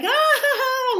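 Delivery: high, gleeful "ah ha ha"; playful squeal. Retold or imitated. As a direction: mimic someone splashing happily in the sea. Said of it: "like playing with the water".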